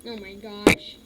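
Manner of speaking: ordinary talk, nothing marked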